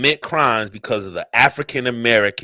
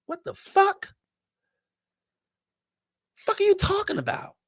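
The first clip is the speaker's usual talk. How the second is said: very high, softer incredulous voice; squeaky disbelief; 9 dB quieter than usual. Pretend. Speaker reacting to the Australian's claim, squeaky high disbelief. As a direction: squeak it out in mock bafflement.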